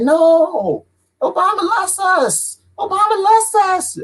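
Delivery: high, slow, put-on believer voice; mocking naive believer. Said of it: "They're like".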